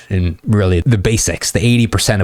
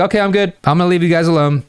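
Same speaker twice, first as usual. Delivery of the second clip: put-on much higher, light girly voice; friend bowing out; 9 semitones higher, faster than usual. Friend telling the couple she's leaving, light and higher. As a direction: play the friend politely excusing herself, pitched up.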